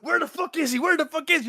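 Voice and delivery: high-pitched retold panic; mock frantic. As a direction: act out frantically demanding an answer. Frantic searching in film scene.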